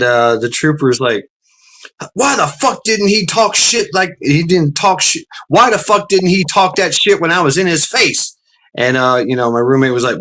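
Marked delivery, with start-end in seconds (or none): 2.1-8.4 higher-pitched mock outrage; imitating an indignant cop; retold indignant demand. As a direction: act out an annoyed trooper demanding an answer. Retold trooper's angry demand.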